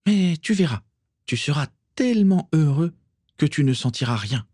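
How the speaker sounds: high-pitched, enraptured promise; starry-eyed reassurance; dreamy rapture. A narrator's reading. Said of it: "murmura rêveusement Bellatrix".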